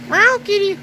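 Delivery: high sing-song call to a cat; pet talk. Real, not acted. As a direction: coax the kitty up high. Calling to a cat in the bales.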